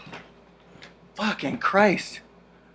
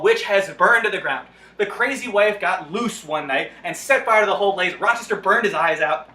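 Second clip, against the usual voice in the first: normal talking voice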